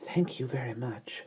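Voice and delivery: soft unvoiced polite whisper. Baby thanking the sunlight in a soft polite whisper. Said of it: "said Annabel politely".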